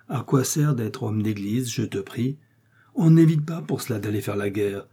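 usual speaking voice

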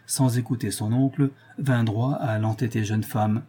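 usual speaking voice

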